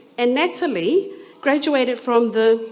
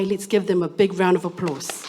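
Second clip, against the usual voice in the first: ordinary talk, nothing marked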